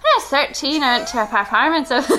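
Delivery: loud, hammed-up Irish attempt breaking into laughter; Irish accent. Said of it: "Read this next one in an Irish accent".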